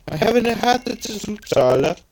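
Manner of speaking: high Swedish lilt; sing-song put-on Swedish accent. Shown as a demonstration. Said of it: "a stereotypical Swedish accent".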